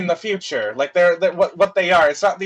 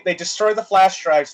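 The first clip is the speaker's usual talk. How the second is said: ordinary talk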